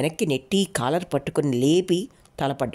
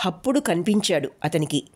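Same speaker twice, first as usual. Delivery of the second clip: plain reading voice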